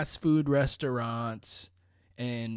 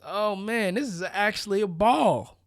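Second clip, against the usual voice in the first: acted realization; 6 semitones higher, 4 dB louder than usual. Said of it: "and they realized".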